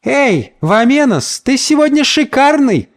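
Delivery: high feminine call; woman's voice. A narrator's reading. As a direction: call admiringly across the street. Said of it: "донёсся с противоположного тротуара женский голос". (высокий женский голос, оклик издали)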